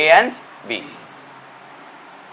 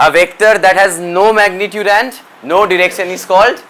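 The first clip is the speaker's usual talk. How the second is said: slow prompting; 3 dB louder, much slower than usual. Shown as a demonstration. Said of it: "তোরা নিজেরাই বলবি".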